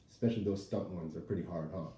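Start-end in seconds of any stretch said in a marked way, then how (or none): none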